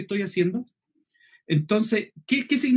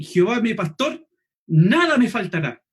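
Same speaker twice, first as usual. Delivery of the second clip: pause, then NADA punched strongly, falling away after; emphatic "nada" after a short pause; stress on NADA; 3 dB louder than usual. Shown as a demonstration. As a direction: hit "nada" hard to promise God's full provision. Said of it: "lo leí con distintos énfasis".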